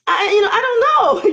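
sudden high shout of not knowing; exasperated outburst. Real, not acted. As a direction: throw up your hands and shout it. Exasperated outburst about telling friends.

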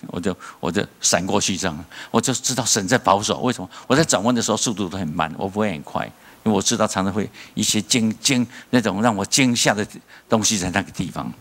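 ordinary delivery

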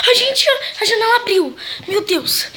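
high, loud frightened cry; startled shriek. Real, not acted. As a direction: gasp it out in fright. Window opens, startled shriek.

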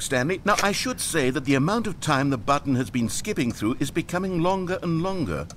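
ordinary talk

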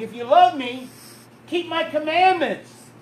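preacher's rising proclamation; preached scripture. Acted. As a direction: proclaim it to the congregation. Quoting Jesus with force.

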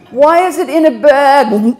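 outraged question between laughs; laughing outrage. Retold or imitated. Retold indignant question.

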